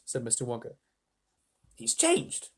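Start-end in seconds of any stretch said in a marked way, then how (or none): 1.7-2.5 put-on high, startled old-man voice; Grandpa Joe. A narrator's reading. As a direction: exclaim it high, amazed at the sight. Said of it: "said Grandpa Joe".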